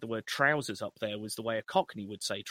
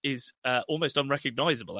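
normal talking voice

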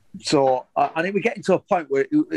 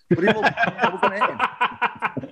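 laughing; 6 semitones higher, slower than usual. Real, not acted. Laughs while retelling fury.

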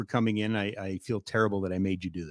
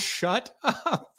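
high, put-on shout; king voice; 11 semitones higher than usual. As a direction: snap it again as the king. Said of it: "when the king comes through".

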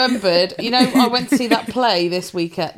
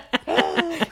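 laughing gasp; 7 semitones higher, 5 dB quieter than usual. Retold or imitated. Mock shocked gasp with laugh.

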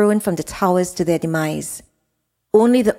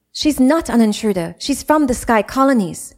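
higher, quick protest; indignant young woman; 6 semitones higher than usual. Acted. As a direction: defend her sister sharply. Jada defends Zora.

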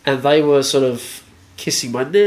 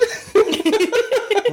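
high laugh. Real, not acted. Laughs at own joke.

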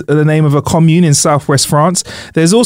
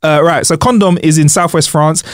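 ordinary delivery

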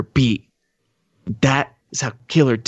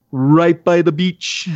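slow, higher put-on Jamaican; fake Jamaican accent; 5 semitones higher, much slower than usual. Thorogood answering where he is from, fake Jamaican accent. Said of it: "walking up with a Jamaican accent".

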